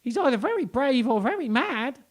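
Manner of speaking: very high, loud monkey chatter; squeaky monkey voice. Acted. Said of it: "chattered a monkey".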